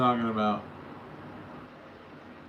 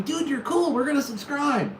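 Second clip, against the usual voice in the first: high, loud eager viewer imitation; hyped-up imitated viewer; mock fan voice; 8 semitones higher, 5 dB louder than usual. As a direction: gush like an excited fan. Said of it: "coming back into the chat and going".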